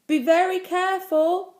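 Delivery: high, loud cautioning call; called warning. A narrator's reading. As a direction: sing out a warning to a child. Warning called to child on gate.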